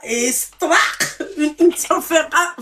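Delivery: loud, drawn-out mock smack; acted-out slap noise. Shown as a demonstration. Host shows caller how she'd have hit him, loud mock slap. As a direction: punch out the slap sound with gusto.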